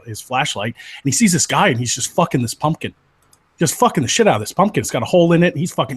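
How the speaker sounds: ordinary talk, nothing marked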